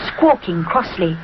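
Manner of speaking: plain reading voice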